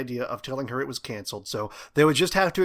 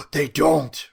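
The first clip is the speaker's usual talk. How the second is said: louder, breathless dramatic reveal; gasped; 2 semitones higher, 7 dB louder than usual. A narrator's reading. Rory reveals his spy theory to Oliver, hammed-up shock. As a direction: gasp it out like a big secret. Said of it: "Rory gasped".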